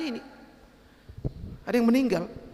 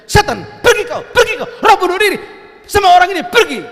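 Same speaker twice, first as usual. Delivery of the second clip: loud commanding rebuke of the devil; rebuking shout; 9 semitones higher, 11 dB louder than usual. Retold or imitated. Preacher re-enacts shouting at the suicide spirit, loud and commanding. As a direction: drive the spirit out with a loud bark. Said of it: "aku bentak-bentak setan itu".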